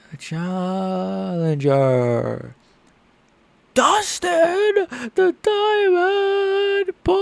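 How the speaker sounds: drawn-out high announcer call; ring announcer voice. Retold or imitated. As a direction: announce him like Bruce Buffer. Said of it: "mimicking ring announcer".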